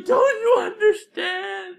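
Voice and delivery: high, slow, laughing wail; mock sobbing plea. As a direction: wail it out through fake tears. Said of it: "I was like".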